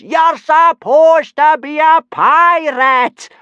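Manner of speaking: slow, very high howl of outrage; exasperated, drawn-out bellow; Gobber howling. A narrator's reading. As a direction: howl it at full volume, word by word. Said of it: "howled Gobber".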